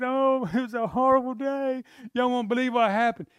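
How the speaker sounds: high, loud, laughing lament of a bad day; Haman complaining. Haman moaning to his wife and friends, loud and high. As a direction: act out Haman's rueful groan. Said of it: "Haman tells his wife".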